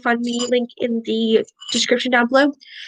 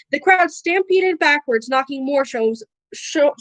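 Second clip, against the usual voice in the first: ordinary delivery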